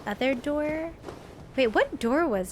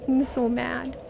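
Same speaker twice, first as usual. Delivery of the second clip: ordinary talk, nothing marked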